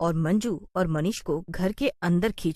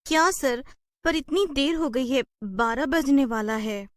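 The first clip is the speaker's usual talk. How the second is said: 1 mild protest; 6 semitones higher than usual. Acted. Worker protests late work.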